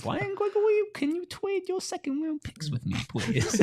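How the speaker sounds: put-on high, soft babyish pleading. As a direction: beg sweetly like a little kid. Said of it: "Why has he got baby voice?".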